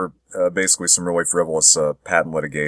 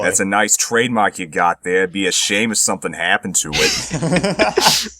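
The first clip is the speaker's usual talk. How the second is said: slow, menacing mock mobster threat; lightly voiced 1940s gangster shakedown; smiling menacing loan shark. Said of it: "stereotypical nineteen forties or fifties gangster".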